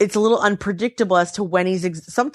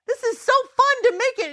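put-on much higher, childlike glee; imitating husband. Imitating husband gushing about making his bong, much higher and giddy. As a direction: do him as an overexcited kid. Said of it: "He goes".

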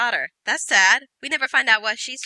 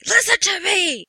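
much higher mock yell of a desperate father; imitated scream; 12 semitones higher than usual. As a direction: shout it like a father losing control. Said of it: "Will Smith is screaming".

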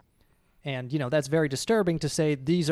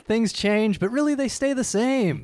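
quoting a TV line, raised pitch; quoting The Wire; 8 semitones higher, 4 dB louder than usual. Retold or imitated. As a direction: recite it like a show's motto. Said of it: "On season five, it's like".

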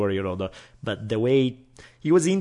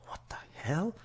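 whispered, higher, confused question; whispered puzzlement; 8 semitones higher, 12 dB quieter than usual. Retold or imitated. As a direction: breathe it out, baffled. Dad puzzled at jammed lock.

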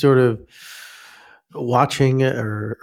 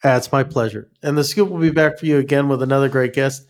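normal talking voice

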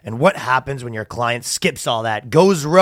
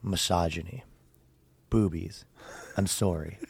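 low, soft, solemn statement voice; mock somber apology read; 5 semitones lower, 8 dB quieter than usual. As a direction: deliver it like a grave press apology. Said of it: "very somberly".

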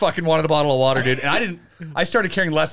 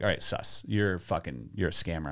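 quiet muttering; 10 semitones lower, 11 dB quieter than usual. Retold or imitated. Calling out the scamming clerk.

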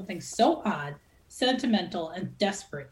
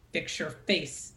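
1 muttered; 4 semitones lower, 5 dB quieter, slower than usual. A narrator's reading. Verity rebukes at the table.

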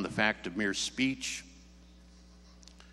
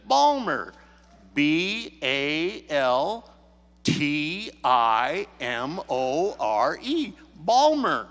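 loud, very slow spelling, letter by letter; high loud name, then drawn-out letters; repeating for the caller; 3 semitones higher, 7 dB louder, much slower than usual. Retold or imitated. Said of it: "asked her to repeat it".

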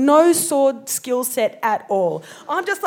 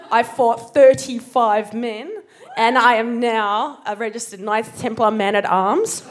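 ordinary talk

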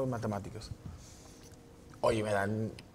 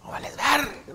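angry dad; 11 semitones higher, 4 dB louder, slower than usual. Retold or imitated. Dad scolding.

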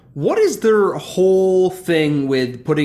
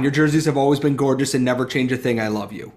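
ordinary delivery

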